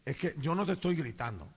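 quiet, low-pitched conciliatory tone; calm denial. Retold or imitated. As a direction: reassure her softly that you are not yelling. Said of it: "le digo".

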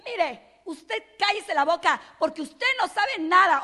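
high, loud mock shouting; imitating an angry yell. A preacher mimics a furious person: loud mock yell. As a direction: act out someone yelling to shut a person up. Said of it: "Cuando una persona que grita".

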